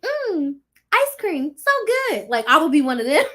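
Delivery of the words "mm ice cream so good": high, breathy food-vlogger voice; mocking live streamers eating on camera; mock mukbang streamer. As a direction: mimic a streamer tasting ice cream. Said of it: "those crazy people that are like".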